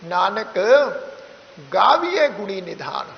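"Nanak" with a rise, then a clear pause before "ਗਾਵੀਏ"; pause after Nanak. Shown as a demonstration. Teacher shows the class the pause after "Nanak". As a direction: hold "Nanak" and let it hang before the rest. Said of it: "ਇੱਥੇ ਵਿਸ਼ਰਾਮ ਦੇ ਕੇ ਪੜ੍ਹਿਆ ਕਰੋ".

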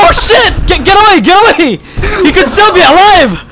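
high, loud panicked yelling to get it away; panicked shouting. Real, not acted. Player yelling at the zombie in panic, loud and high. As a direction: scream at the infected to back off.

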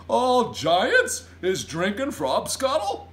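loud, slow, high giant voice; booming, drawn-out declaration; giant's announcement. A narrator's reading. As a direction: proclaim it proudly and broadly. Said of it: "announced the B F G".

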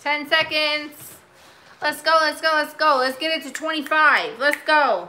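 rally the bidders before time runs out; countdown hype. Real, not acted. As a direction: seller cheering viewers on at the countdown, excited. Hyping the final auction countdown.

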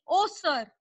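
bursting out suddenly; sudden exclamation. Acted. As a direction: blurt it out, loud. Teacher voicing girl bursting out.

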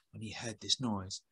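usual speaking voice

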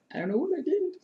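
high squeaky bewildered question; high little voice of confusion; squeaky puzzled. Real, not acted. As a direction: squeak it out, baffled at herself. Puzzled over her own stitching.

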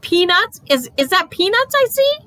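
very high, amazed toy voice; gasping surprise. A narrator's reading. Stuffed animal spotting peanuts, high gasping surprise. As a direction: squeak it in disbelief, stumbling on the question. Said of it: "Philip did a gasp".

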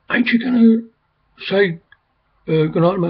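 high mock whine of the porter; a high put-on voice for the porter asking for good night; mimicking the porter. Porter pestering the speaker, imitated high. Porter's whiny retold request.

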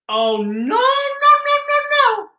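squeaky high no, no, no; hammed-up dismay at the ending; mock horror. Narrator reacts to grim ending.

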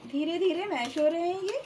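ordinary talk

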